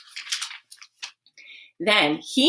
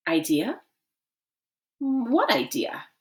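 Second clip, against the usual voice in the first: playing dumb; 6 semitones higher than usual. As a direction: feign blank innocence. Nick plays dumb.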